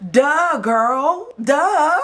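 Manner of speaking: very high, loud mocking duh. Real, not acted. Vlogger scoffing at the cast member, high sarcastic duh. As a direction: drag the duh out with sass.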